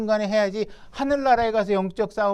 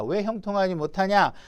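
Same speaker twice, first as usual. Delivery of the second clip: ordinary talk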